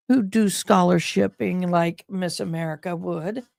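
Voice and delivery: ordinary talk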